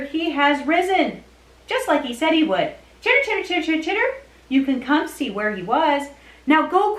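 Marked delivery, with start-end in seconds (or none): 3.0-4.1 long run of high squeaky chitters; chittering puppet. Pretend. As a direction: chatter on and on like a critter puppet. Angel puppet speaks.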